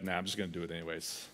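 low, quiet, quick brush-off; a lazy mumbled nah; dismissive shrug. Retold or imitated. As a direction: toss it off like you don't care. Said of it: "you're like".

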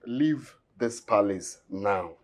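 quiet, slow, icy order; low menace, slowly; cold command. Acted. Guard dismisses visitor coldly.

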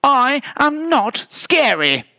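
high, slow, emphatic protest; high-pitched affronted bear; indignant shout. A narrator's reading. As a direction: shout each word in protest. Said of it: "shouted the polar bear".

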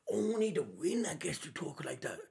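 breathy whisper of the villain's taunt; whispered villain line. Retold or imitated. Reactor whispering Lucci's taunt back, mock menacing.

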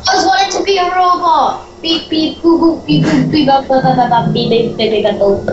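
beeping robot noises, high at first; toy robot voice. Pretend. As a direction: beep boop like a little toy robot. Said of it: "you have turned into a robot".

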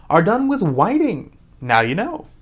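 usual speaking voice

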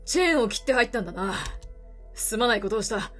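put-on gruff weary older man, low. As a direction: speak low like a tired drifter. Voices the reporter.

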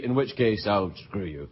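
quiet mock threat to repeat viewers; low mock threat. Real, not acted. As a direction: drop it low like a sneaky insult.

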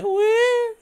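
high whooping cheer; excited woo. Real, not acted. Player cheering as the plane climbs, whooping.

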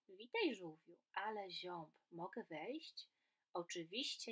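lively storybook reading with voiced animals; reading to children. A narrator's reading. Teacher reading a story aloud to children, lively and animated. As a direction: read it like a bedtime tale for kids.

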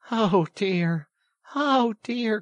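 high, loud exasperated lament; despairing cry. A narrator's reading. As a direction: despair at a dense friend. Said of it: "in despair at his obtuseness".

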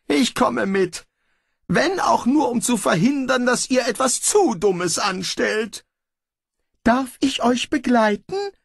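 high, fretful little man; reluctantly agreeing to come; high fussy voice. Acted. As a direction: grumble along reluctantly. Pismeier and Eulenglas speak.

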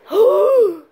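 sharp high gasp of shock; surprised gasp. Acted. Seeing the calendar date, a shocked gasp. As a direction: gasp loudly, caught off guard.

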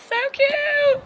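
gushing. Real, not acted. Gushing over her outfit.

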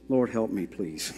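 low, soft aside to God; muttered prayer. Real, not acted. Preacher asks the Lord for help, muttered low. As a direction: drop down into a quick private plea.

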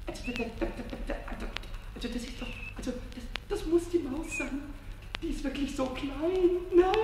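very soft, stammering wonder; hushed amazement. Acted. Pumuckl spies the tiny mouse head, hushed and amazed. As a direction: murmur so the mouse won't hear. Watching the mouse, afraid to startle it.

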